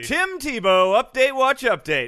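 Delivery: loud high alert shout; shouted news alert. Acted. Cohost announcing the Tebow news alert, shouted high. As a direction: yell it like a breaking news drop.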